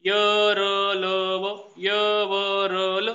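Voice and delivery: र, ल, व spaced out very slowly; slow dictation of the letters; slow listing. Shown as a demonstration. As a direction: dictate र, ल, व one by one. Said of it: "असा लावायचा".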